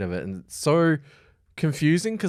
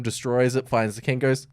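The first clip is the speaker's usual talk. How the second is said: ordinary talk, nothing marked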